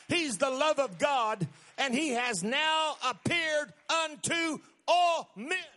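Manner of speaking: building to a loud, high shouted climax; preaching at full force. Real, not acted. Preacher lifting the congregation toward a shout.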